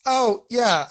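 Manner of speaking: ordinary talk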